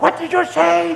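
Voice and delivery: high-pitched mock outrage; imitated high, affronted comeback; indignant challenge. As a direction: whip round and demand an answer. Retold companion whips around, challenging.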